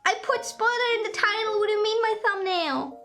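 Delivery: high, loud startled disbelief; what, how, a real outburst of surprise; shocked disbelief. Real, not acted. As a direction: caught off guard by a spoiler, voice jumps high. Reacts to spoiler in thumbnail.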